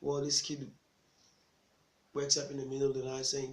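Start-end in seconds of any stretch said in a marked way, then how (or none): none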